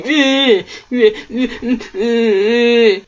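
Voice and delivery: hammed-up pretend sobbing sounds; imitating forced crying with no tears; mock fake sobs. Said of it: "they go".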